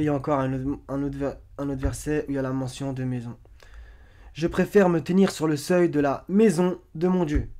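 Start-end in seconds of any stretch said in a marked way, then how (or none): 4.3-7.5 raised, forceful scripture reading; emphatic verse reading. Acted. Presenter quoting a psaume to make a point, emphatic reading. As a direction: declaim the verse, hitting key words loudly.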